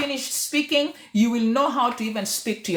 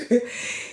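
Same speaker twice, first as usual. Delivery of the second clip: short, breathy real chuckle; laughing. Real, not acted. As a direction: let the laughter tail off. Laughs after the retold comment.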